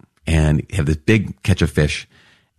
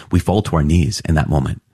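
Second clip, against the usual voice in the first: ordinary talk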